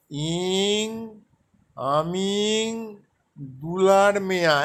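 আমি drawn out very slowly, then দুলার Mia said clearly; teacher spelling out the Santali words slowly; slow Santali drill. Shown as a demonstration. Teacher drills learners on a Santali phrase, very slow. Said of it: "একটা বাক্য মুখস্থ করুন".

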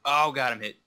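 ordinary talk, nothing marked

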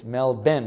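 Melbourne as MEL-BEN, like Benjamin; careful Melbourne with a clear "ben" second syllable; "ben" ending. Shown as a demonstration. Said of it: "like Benjamin".

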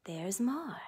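hushed infomercial tease; whispered tease. Acted. Crafting host teasing viewers, whispered mock pitch. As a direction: breathe it as a secret bonus.

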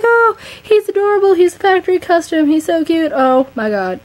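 high, loud squeal of delight over a gift; squealing excitement. Retold or imitated. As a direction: gush like you just opened the best present. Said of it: "and I was like".

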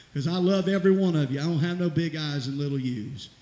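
loud, rousing preacher call; preaching at full force. Real, not acted. Pastor calling the church to the altar, loud preaching. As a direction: lift the whole church, full voice.

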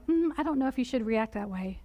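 soft and low, a gentle nudge; quietly coaxing, the Spirit's gentle nudge; still small voice. Acted. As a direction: hush it like an inner voice. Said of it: "speak to you in a still, small voice".

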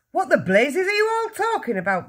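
high, indignant yell. A narrator's reading. Father baffled by the family's uproar, yelling. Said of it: "the father yelled".

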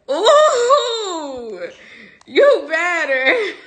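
high, slow coo with a laugh. Real, not acted. Cooing at the baby.

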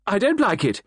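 high, loud, wailing protest. A narrator's reading. Mrs Ackroyd to Poirot, wailing to leave. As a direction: wail it out in panic. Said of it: "she wailed".

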